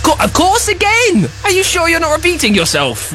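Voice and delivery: high, excited accusing; playful high-pitched accusation; mock outrage. Real, not acted. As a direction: pounce on the slip, playfully indignant. Host pounces on repeated phrase.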